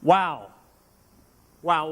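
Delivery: ordinary delivery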